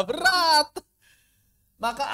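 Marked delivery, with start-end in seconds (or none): 0.1-0.8 high, loud strained berat; strained complaint; mock strain. Shown as a demonstration. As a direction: groan it out as too hard. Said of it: "tanpa qalqalah".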